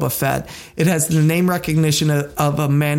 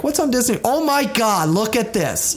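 excited gasp; 6 semitones higher than usual. Retold or imitated. Mock excited viewer quote.

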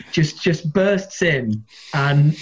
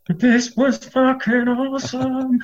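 much higher and slow, savouring the thrill; inner elation; 10 semitones higher, slower than usual. Retold or imitated. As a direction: gush silently to yourself about the set. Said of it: "But inside, I'm like".

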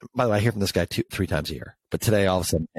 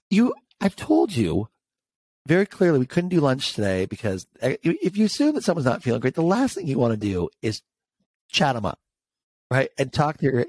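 reliving the fury at the director, much higher; angry retold rant; 6 semitones higher than usual. To the director who called, an indignant high-pitched retold scolding. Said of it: "Oh, I was so angry".

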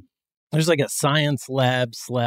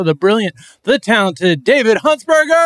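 high, loud hype welcome; announcer shout; 8 semitones higher, 8 dB louder than usual. Acted. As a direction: introduce him like a game show host. Announcing the guest.

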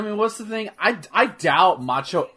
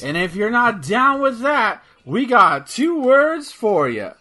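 wrestling promo; 9 semitones higher, 5 dB louder, slower than usual. Acted. Wrestling catchphrase build-up to crowd.

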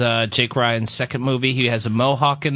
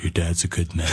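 low, soft husky woman's voice; husky Linda Fiorentino voice; 9 semitones lower, 5 dB quieter than usual. Acted. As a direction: purr it low like a husky femme fatale. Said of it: "this is Linda Fiorentino talking about Ronnie Cox".

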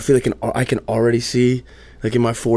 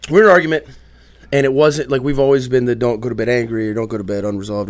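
ordinary talk, nothing marked

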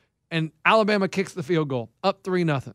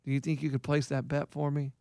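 low, soft, deadpan coach impression; Keith; 5 semitones lower, 9 dB quieter than usual. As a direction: imitate an older friend's low, quiet phone voice. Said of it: "that's not how he talks".

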